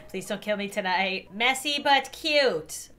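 ordinary talk, nothing marked

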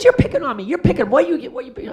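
put-on high-pitched complaining young woman; whiny protest. Actress complaining to the director, high whiny protest. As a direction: whine it, feeling picked on.

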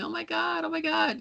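mock fan screaming, pitched very high; freaking out. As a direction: squeal it like an overwhelmed fan meeting a god. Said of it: "yelling and screaming and freaking out and crying and going".

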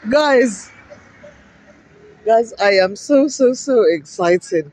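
drawn-out so so excited with a laugh; laughing excitement. Real, not acted. Vlogger gushes to viewers about Kilburn changing, laughing. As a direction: gush with delight.

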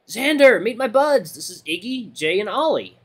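high, excited introductions, loud at first; eager friend. Acted. Redline introducing Xander to his buddies, eager and loud. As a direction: proudly show off your buddies.

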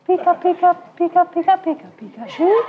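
high squeaky cartoon creature; Pikachu voice. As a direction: chirp it like a little Pikachu. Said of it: "and they said".

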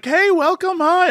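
high, bright clerk greeting; mock cheery sales lady; chirpy cashier. Cashier greeting a customer, high chirpy welcome. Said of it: "this lady's like".

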